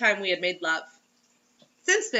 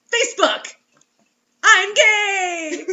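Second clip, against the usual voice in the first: high, loud proud proclamation; mock announcement; 10 semitones higher, 10 dB louder, much slower than usual. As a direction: shout it to the world.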